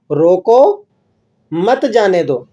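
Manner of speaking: "روکو", a break, then "مت جانے دو" together; pause after روکو. Shown as a demonstration. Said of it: "آپ وقف کرتے ہیں کہاں پر؟".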